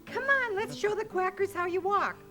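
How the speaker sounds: ordinary talk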